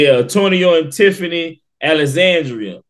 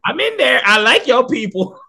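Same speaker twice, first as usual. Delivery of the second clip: a high put-on voice for her reply; playfully mimics the actress, voice pitched up; imitating a woman; 9 semitones higher than usual. The host mimics the actress's excited reply in a higher voice. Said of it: "It's like".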